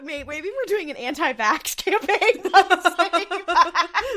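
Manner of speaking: high, loud words breaking into laughter; laughing. Real, not acted. Podcaster realizing the joke backfires, laughing hard. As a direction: barely get it out for laughing.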